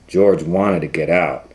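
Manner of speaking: ordinary delivery, nothing marked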